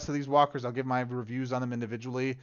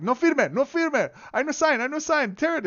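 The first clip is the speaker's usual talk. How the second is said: much higher, louder frantic Spanish-tinged cry; panicked refusal; 13 semitones higher, 6 dB louder than usual. Retold or imitated. Stepmother refusing the hospice papers, frantic and high. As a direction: beg them to tear it up. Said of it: "my sister says it was like".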